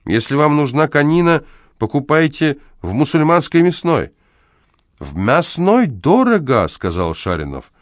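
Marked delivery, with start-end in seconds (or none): 0.0-4.1 high-pitched, mildly hysterical scolding; hysterical shouting. A narrator's reading. House manager scolding the horse's owner, mildly hysterical. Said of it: "истерически кричал управдом". 5.0-6.7 high, slow drawn-out protest; indignant retort. A narrator's reading. Neighbour answering the house manager about meat prices, indignant.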